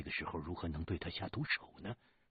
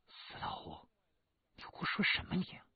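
indignant woman's retort. Acted. Shirley Yang lashing back at Hu, high indignant woman. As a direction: snap back at him in outrage.

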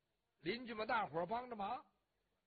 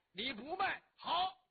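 loud, raised-pitch threat; menacing ultimatum; 7 semitones higher, 5 dB louder, slower than usual. A narrator's reading. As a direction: build to a sudden punched last word. Bully's final threat after refusals.

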